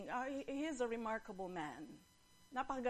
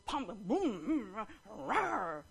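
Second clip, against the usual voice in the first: imitated thudding steps and roar; playful boom-boom and rawr; lion's arrival noises; much slower than usual. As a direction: stomp in vocally, then roar. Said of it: "So he comes".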